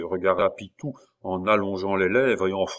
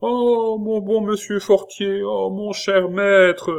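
much higher, slow, despairing cry; desperate pleading; 11 semitones higher, 5 dB louder, slower than usual. A narrator's reading. Desperate pupil begging his master. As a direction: wail for mercy. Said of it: "l'écolier au désespoir".

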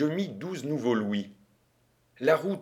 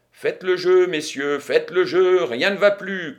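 raised, ringing table announcement; croupier's cry; 4 semitones higher, 6 dB louder than usual. A narrator's reading. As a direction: call the bets out to the room. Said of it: "cria le croupier".

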